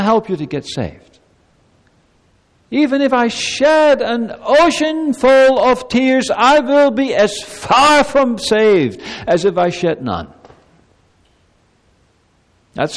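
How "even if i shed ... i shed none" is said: loud, slowed preaching emphasis; forceful preaching. Acted. Pastor insisting tears cannot save, forceful preaching. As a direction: lean on every word.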